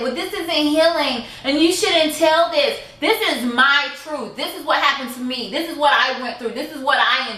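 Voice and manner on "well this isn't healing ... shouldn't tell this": higher, snooty voice mimicking a critic; mocking quote. To family members who judge her healing, mocking higher voice. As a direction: parrot the relatives' lecture with a sneer. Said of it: "fix your mouth and say".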